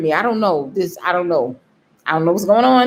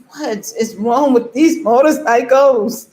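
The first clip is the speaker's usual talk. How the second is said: drawn-out, higher mock-crying complaint; boo-hooing; 5 semitones higher, 4 dB louder than usual. Rider retelling her motorcycle ride, mock boo-hooing. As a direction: wail it slowly in mock despair. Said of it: "Just crying and boo-hooing".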